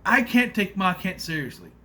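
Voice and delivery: ordinary talk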